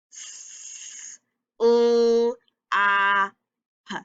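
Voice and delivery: S, L, A, P as separate held sounds; teacher segmenting S, L, A, P sound by sound. Shown as a demonstration. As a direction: stretch each sound of S-L-A-P with gaps between. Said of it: "We heard four sounds".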